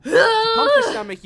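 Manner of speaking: loud exclamation; dramatic shout. Acted. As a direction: blurt it out alarmed. Narration dramatic burst.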